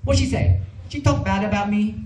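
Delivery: put-on high, jealous Vietnamese-accented mother; upset mother questioning her son about a dream, high and accented; imitating upset mom. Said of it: "She was like".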